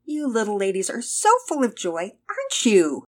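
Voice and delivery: put-on loving baby talk. A narrator's reading. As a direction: gush lovingly at little ones with a smile. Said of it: "lovingly smiled at them".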